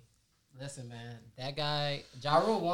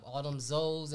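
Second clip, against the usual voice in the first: usual speaking voice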